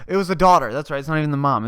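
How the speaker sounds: usual speaking voice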